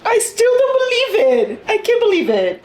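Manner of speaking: high, wailing protest; upset outcry verging on laughter; distraught disbelief. Real, not acted. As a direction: refuse the news, voice way up. Cannot accept character's death.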